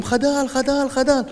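high, urgent cease-fire call; retold shout. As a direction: shout for them to stop firing. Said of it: "אני צועק להם".

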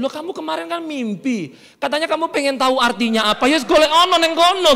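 ordinary delivery

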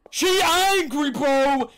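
slow, drawn-out wail of alarm; alarmed cry. Real, not acted. Player warning that the monster is angry, wailing. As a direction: cry it out, stretching the words. Same words cried out in alarm.

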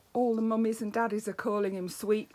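ordinary delivery, nothing marked

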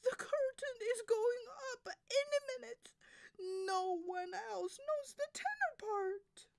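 very high, loud diva distress; panicking soprano. Acted. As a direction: fret loudly before curtain-up. Soprano panicking before curtain.

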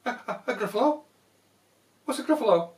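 hammed-up nervous fox; startled fox. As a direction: stutter it in alarm.

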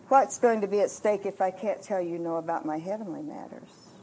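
God's quiet reproof, acted softly; gentle correction. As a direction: correct her tenderly and quietly. Retold God gently correcting her.

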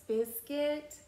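plain reading voice

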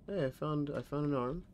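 high, startled and eager; excited. Real, not acted. Startled find in horror game.

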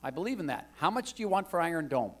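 a hint of an eager Texas legislator pointing at the Bible; retelling the legislator urging him on; imitating the congressman. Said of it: "He said".

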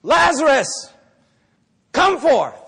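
loud, high call to the tomb; mock summoning shout. Preacher at Lazarus' tomb, acted loud summons. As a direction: command the dead in a hammed-up shout. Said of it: "standing in front of Lazarus' tomb".

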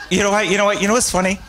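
suddenly bursting with a realisation; excited outburst. Real, not acted. Answering the audience question, excitedly remembering something funny. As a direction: pitch jumps high and loud, words tumbling out in a rush.

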